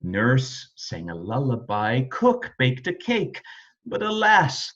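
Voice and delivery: ordinary delivery, nothing marked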